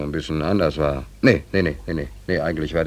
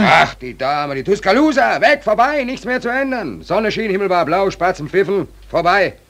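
despairing outburst; 14 semitones higher, 7 dB louder than usual. Acted. Verzweifelter Ausbruch.

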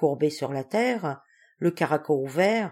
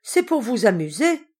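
raised, higher accusing voice; exclaiming; 6 semitones higher, 6 dB louder than usual. A narrator's reading. Mistress accusing the servant of wanting fun, exclaiming. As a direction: cry it out as an accusation. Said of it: "s'écria mademoiselle Solange".

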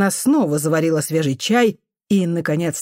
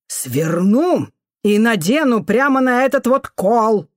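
raised, slower threat with heavy emphasis; angry threat; 4 semitones higher, 4 dB louder, slower than usual. A narrator's reading. Janitor woman gripping the boy by the shoulder, menacing. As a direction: threaten the child, drawing the words out.

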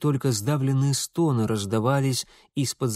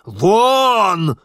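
loud, high drawn-out roar; furious yell; 7 semitones higher, 10 dB louder than usual. A narrator's reading. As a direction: roar them out of the room. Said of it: "заорал отец".

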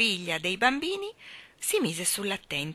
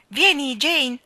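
high, loud impatient call; shouted; 8 semitones higher, 10 dB louder, slower than usual. A narrator's reading. Michael calls his sister, shouting impatiently. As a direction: yell for her across the room. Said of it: "le gridò impaziente".

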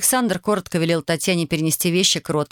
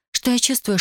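pleading Tatiana; 6 semitones higher than usual. A narrator's reading. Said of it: "Она умоляюще протянула к нему руки".

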